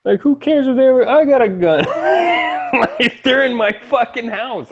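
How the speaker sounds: high laughing shout at the absurdity; outraged shout. Real, not acted. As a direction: yell it in disbelief, cracking up. Outraged rant about armed intruders.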